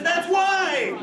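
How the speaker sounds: high, loudish mock wail; hammed-up weeping punchline; mock crying. Crying mourner answers why he weeps, high mock wail. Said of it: "he's crying and he's weeping".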